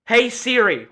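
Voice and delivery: high, loud summons; insistent calling. Real, not acted. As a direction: call out firmly to the phone. Calling assistant after outburst.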